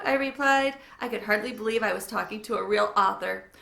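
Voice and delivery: plain reading voice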